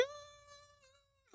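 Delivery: tiny, very high squeal; thin high mock scream, kept very quiet; imitated squeal. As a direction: squeal softly like excited viewers, mock reaction. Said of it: "and then people were like".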